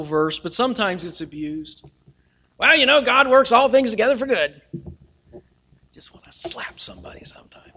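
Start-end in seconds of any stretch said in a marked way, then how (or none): 2.6-4.6 mock pat answer. 5.9-7.7 whisper. Real, not acted. Aside about pat answers.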